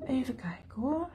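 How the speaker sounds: whispered aside. Real, not acted. Crafter checking the picture on her livestream, whispering. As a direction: whisper it under your breath to yourself.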